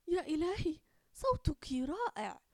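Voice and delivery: high, loud gasp of wonder; amazed. Acted. Fatima amazed by her friend's singing voice. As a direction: burst out in delighted amazement. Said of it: "وقالت بعينين متسعتين".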